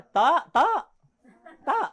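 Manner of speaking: gentle Ilonggo singsong warning; lightly voiced Ilonggo sweet threat; Ilonggo lilt. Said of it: "Binawarningan ka na".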